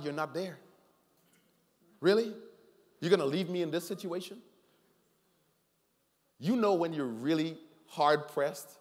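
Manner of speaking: ordinary talk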